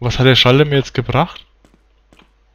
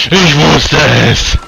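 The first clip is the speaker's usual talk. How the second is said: triumphant drawn-out exclamation; 3 dB louder, much slower than usual. Real, not acted.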